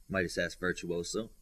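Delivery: usual speaking voice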